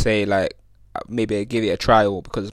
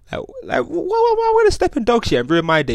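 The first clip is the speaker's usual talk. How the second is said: exasperated rant. Real, not acted. Ranting about stepping in dog shit.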